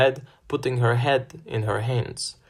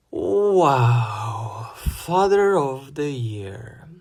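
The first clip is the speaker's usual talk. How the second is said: sarcastic mutter; 2 semitones higher, much slower than usual. A narrator's reading. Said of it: "I muttered".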